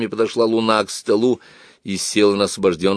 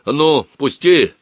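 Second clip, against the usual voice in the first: raised, irritated protest while struggling free; louder and higher, straining to break loose; вырываясь; 7 semitones higher, 6 dB louder, slower than usual. A narrator's reading. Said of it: "бормотал Бакланов, стараясь освободиться". (сердито, стараясь освободиться)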